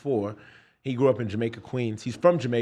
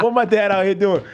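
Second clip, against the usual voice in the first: laughing disbelief; 7 semitones higher, 5 dB louder than usual. Real, not acted.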